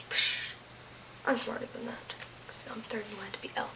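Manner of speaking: breathless whispered joke; whispered aside. Real, not acted. Teacher whispers a joke aside to viewers. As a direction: drop to a secret whisper.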